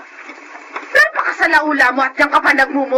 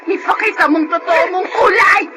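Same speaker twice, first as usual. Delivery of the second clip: screaming, rising high and crying; furious tearful shout; 4 semitones higher than usual. Acted. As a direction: yell at the girl to come here. Grandmother screaming in quarrel.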